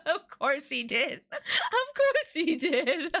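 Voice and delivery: slow words through real laughter; cracking up while talking; helpless laughter. Real, not acted. Cracking up at the character.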